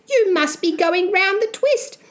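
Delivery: high-pitched scornful mother; hissed scolding. Acted. Mother doubting her son's sanity, high scolding. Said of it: "his mother hissed".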